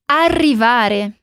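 "Arrivare" with a strongly trilled rr, slowly; strong rolled R. Shown as a demonstration. Teacher drilling "Arrivare" for students, slow strong trill. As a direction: roll the rr hard in "Arrivare", slowly. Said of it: "com um som do R bem forte".

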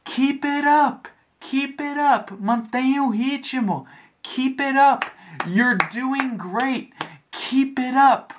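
high, excited cheering yell; yelling encouragement. Retold or imitated. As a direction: cheer a runner on from ahead. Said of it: "A J kept on yelling".

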